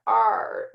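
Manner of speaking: R drawn out as a long "arr"; pirate R sound. Shown as a demonstration. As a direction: stretch R like a pirate's "arrr". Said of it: "is kind of a pirate sound".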